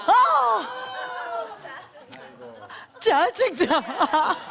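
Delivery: 높고 크게 터뜨리는 짜증, 짜증나는 아주 느리게 늘여; 웃음 섞어 과장된 짜증을 터뜨리듯. Retold or imitated. (남편 말에 폭발한 아내, 과장된 짜증)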